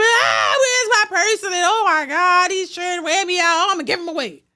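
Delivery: mock a whiny crier begging for sympathy; mock crying. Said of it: "crying and screaming".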